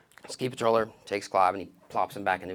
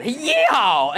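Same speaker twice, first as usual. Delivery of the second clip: cheering; 12 semitones higher, 6 dB louder than usual. Retold or imitated. Cheer while sliding.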